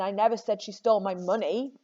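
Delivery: "money" stressed at the very end. Shown as a demonstration. Teacher shows students how meaning shifts, stressing "money". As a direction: land the weight on "money". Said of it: "where on earth you put the emphasis".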